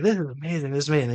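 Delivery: wowed, acting out the moment; retold amazement. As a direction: gush as if reliving the thrill. Retelling wonder at night ride.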